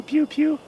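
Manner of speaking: high squeaky pew sounds; high little pew calls; squirrel noise imitation. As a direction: mimic the squirrel's call. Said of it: "The squirrel that's going pew".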